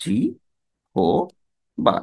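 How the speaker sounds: জি হো বা, pulled apart into three syllables. Shown as a demonstration. Teacher breaking up a wrong pronunciation for the class. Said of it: "এরকম উচ্চারণ করি".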